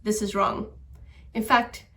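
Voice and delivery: ordinary talk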